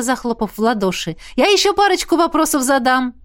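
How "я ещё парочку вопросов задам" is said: high, eager chime-in; delighted. A narrator's reading. Miranda plans extra questions, delighted. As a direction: add it with lifted eagerness. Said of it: "обрадовалась Миранда".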